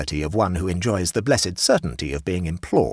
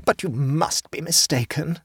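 mildly insistent higher hostess, slow; persisting hostess; 10 semitones higher than usual. A narrator's reading. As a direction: gently keep pushing back. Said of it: "persisted Missus Riversedge".

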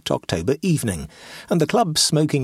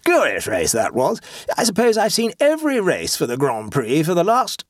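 higher, hearty club bore holding forth; pompous clubman; 8 semitones higher than usual. A narrator's reading. A club bore forcing racing talk on his neighbour, higher and hearty. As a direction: boom out the racing memories like a bore. Amblecope boasting to Treddleford.

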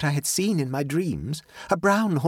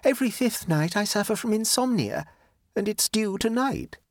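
high-pitched, pitiful complaint; pathetic; 13 semitones higher than usual. A narrator's reading. Lola warning she shan't sleep, pathetically. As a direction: plead helplessness in a high voice. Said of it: "said Lola pathetically".

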